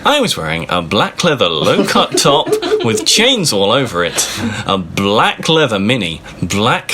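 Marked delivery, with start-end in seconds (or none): none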